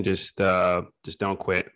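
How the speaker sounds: ordinary delivery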